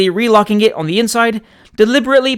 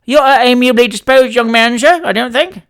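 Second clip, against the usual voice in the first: louder, higher sarcastic scolding; moral reproof; 5 semitones higher, 5 dB louder than usual. A narrator's reading. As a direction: reproach him like a preacher. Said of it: "in a tone of moral reproof".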